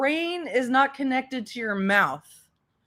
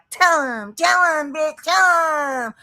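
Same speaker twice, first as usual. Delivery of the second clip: egging on; 4 semitones higher, 3 dB louder than usual. Retold or imitated. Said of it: "you're like".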